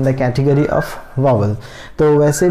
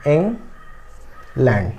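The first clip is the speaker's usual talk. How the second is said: England broken in two with a long gap; split into syllables; 5 dB quieter, much slower than usual. Shown as a demonstration. Teacher breaking England apart for students, syllable by syllable. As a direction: say England as two separate beats, ING, then LAND. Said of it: "England को हमें तोड़ना पड़े".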